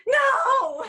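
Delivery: a sudden high, loud cry of no; shocked no. Retold or imitated. Alex refusing the hot tea, shouting high. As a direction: blurt it out in alarm. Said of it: "And Alex went".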